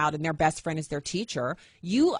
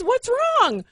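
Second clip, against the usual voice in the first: much higher, louder worried son; alarmed son; 6 dB louder than usual. Retold or imitated. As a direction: play the startled boy rushing in. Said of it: "He comes in, he says".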